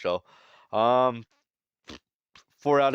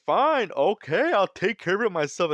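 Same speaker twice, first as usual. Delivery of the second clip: mock exasperated villain; 10 semitones higher, 3 dB louder than usual. Saturn giving up on the Marines, high hammed-up huff. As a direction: whine it like a fed-up cartoon villain. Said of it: "and Saturn's like".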